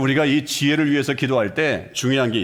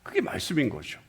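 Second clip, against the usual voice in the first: whisper; 7 dB quieter than usual. Real, not acted. Sermon aside.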